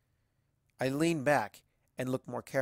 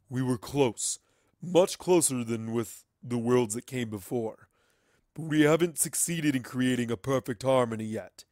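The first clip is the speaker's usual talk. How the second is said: louder, alien voice, calmly lecturing; otherworldly entity; 7 dB louder than usual. Acted. The entity explaining its plan to Twilight, loud and otherworldly. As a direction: speak like a being above mortals.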